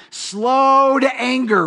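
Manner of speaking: slow to anger, stretched high and long; slow, drawn out. Shown as a demonstration. Preacher savoring slow to anger for the congregation, drawn out. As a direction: let each word of slow to anger linger.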